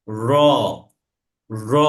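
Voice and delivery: র with a light tongue trill; letter র demo. Shown as a demonstration. Teacher models a trilled র for the class. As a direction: let the র vibrate lightly. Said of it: "আপনার রটা কাঁপবে".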